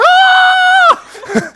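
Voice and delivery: high, loud shriek of fright; sudden scared scream; panicked yelp. Retold or imitated. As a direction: yelp as if something jumped out. Preacher yelps at the fog.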